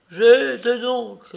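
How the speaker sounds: the deceived father crying out; cried out. A narrator's reading. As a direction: break out in a shout. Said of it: "s'écria-t-il".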